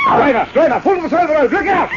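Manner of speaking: high, alarmed shout at the driver; shouted warning. Acted. Passenger screaming warning to the driver, panicked shout. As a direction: yell to the driver to swerve in panic.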